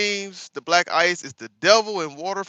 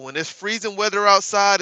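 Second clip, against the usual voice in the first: usual speaking voice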